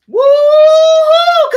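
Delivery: very high, loud, long woo-hoo; piercing drawn-out cheer; celebratory woo-hoo. Real, not acted. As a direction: whoop it out at full volume. Celebrating the winner.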